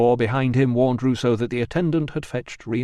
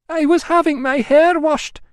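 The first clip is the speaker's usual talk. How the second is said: high, raised female voice; Emilia; 7 dB louder than usual. Acted. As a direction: explain yourself a bit loudly.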